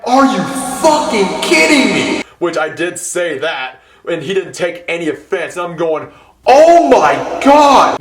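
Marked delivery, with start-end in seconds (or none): 0.0-2.2 loud, high, disbelieving outburst; shouting are you fucking kidding me; exasperated shout. Retold or imitated. Mats suddenly available after all. 6.4-8.0 slow, loud, high cry of panic; drawn-out loud panicked oh my God; frantic yell. Retold or imitated. Frantic rush, headless chicken.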